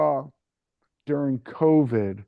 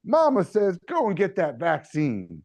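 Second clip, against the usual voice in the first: mock Mama ad voice; 7 semitones higher than usual. Ad woman urging listeners to get vaccinated, in a high cooing voice. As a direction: coax them like a folksy mama in a TV ad. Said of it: "She's like".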